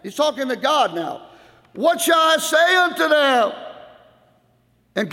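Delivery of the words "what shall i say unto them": high, loud pleading question; Moses pleading. Acted. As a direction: ask God urgently, voice raised high. Moses asking God.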